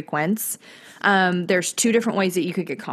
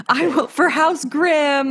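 put-on pompous kid voice; 6 semitones higher, slower than usual. Imitating the boastful kid.

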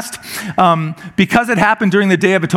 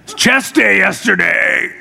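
slow, hammed-up complaint of sore pecs; milk the soreness for sympathy; showy pained whine; slower than usual. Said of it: "What's wrong?".

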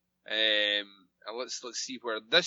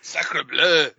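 mock French exclaim, loud; a hammy French chef cry; French accent; 2 semitones higher, 8 dB louder than usual.

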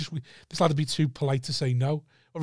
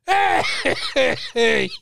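high laughing hey; giggly mock greeting; 9 semitones higher, 4 dB louder, much slower than usual. Mate bouncing over laughing.

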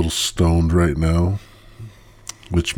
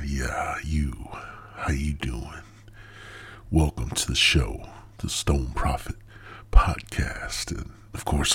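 creepy whisper; 3 semitones lower, 7 dB quieter than usual. Acted.

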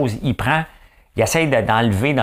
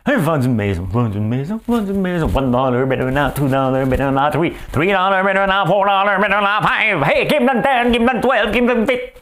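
building from a little higher to much higher and louder, mock boasting; mock bragging realtor; 9 semitones higher, 3 dB louder than usual. Realtors brag about sales on social media, hammed-up crowing. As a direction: crow louder with every bid like a gloating realtor.

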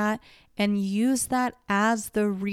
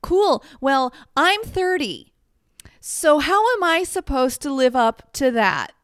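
higher, louder acted protest; mock exasperation; 6 semitones higher, 5 dB louder than usual. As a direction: react like someone hopelessly outdone. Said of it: "you're just like".

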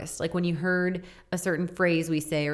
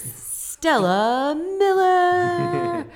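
booming, drawn-out arena announcer; 2 semitones higher, 6 dB louder, much slower than usual. Shown as a demonstration. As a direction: boom out the name, stretched slow. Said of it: "use the announcer voice".

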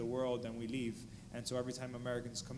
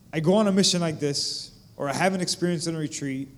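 loud, raised and urgent, near preaching; impassioned testimony; 2 semitones higher, 11 dB louder than usual. Real, not acted. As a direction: pour it out to the crowd with full voice.

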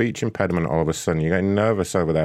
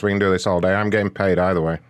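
ordinary talk

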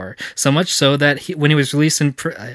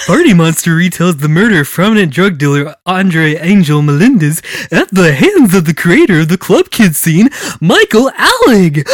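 loud, high cartoonish clown voice; wacky clown voice; 4 semitones higher, 9 dB louder than usual. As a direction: honk it out like a goofy clown. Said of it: "in my wacky clown voice".

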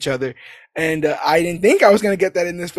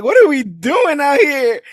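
higher, louder, amped-up exclamation; excited reaction; 9 semitones higher, 5 dB louder than usual. Retold or imitated. Reacting to the songs they play, excited outburst. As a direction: exclaim it in hyped disbelief.

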